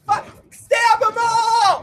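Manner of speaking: high, slow, dragged-out yell; frenzied shout. Real, not acted. Cheering the battle on screen with a slow shout. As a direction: howl it like a war cry. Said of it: "apologize for my freaking out in there".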